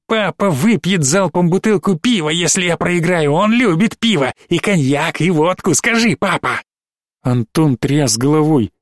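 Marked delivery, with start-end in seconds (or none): 0.1-6.6 put-on high, taunting possessed child voice; mock the parents like a gloating little monster; taunting demon child. Одержимая девочка дразнит отца. (дразнящий одержимый ребёнок, высоко)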